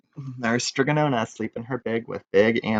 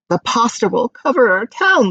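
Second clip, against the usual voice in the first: high, louder frightened cry; 12 semitones higher, 4 dB louder than usual. Acted. Townspeople cry out in fear of the pasta, high. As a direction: wail in fear. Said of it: "they cried".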